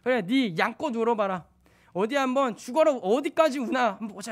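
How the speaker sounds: put-on a father daring his crying child to keep going; snapping at a wailing toddler, fed up; irritated. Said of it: "짜증이 나가지고".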